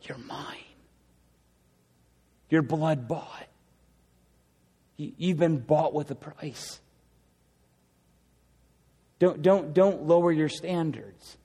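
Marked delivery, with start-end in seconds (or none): 0.0-6.8 much softer, pleading, not condemning; tender plea. Pretend. Jesus pleading with his people, hushed and tender. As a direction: plead it gently, almost hushed. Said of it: "it would be a plea of".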